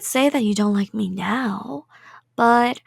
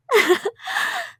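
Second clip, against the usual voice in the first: high creepy giggle; 14 semitones higher than usual. Acted. Yandere giggle.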